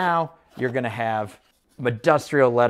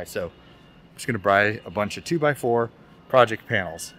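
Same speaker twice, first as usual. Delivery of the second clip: muffled behind a mask; talking through a dust mask; through a face mask; 7 semitones lower than usual. Real, not acted. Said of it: "I don't know if you guys can hear me with the, uh, the mask on".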